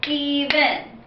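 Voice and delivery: Even split into two syllables, E-ven, each one clapped. Shown as a demonstration. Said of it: "I'm gonna clap it out".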